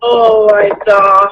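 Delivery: tearful sympathy. Real, not acted. Mother reacting to daughter's acne struggle, crying. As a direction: voice breaks for her daughter. Mother hearing painful skin story.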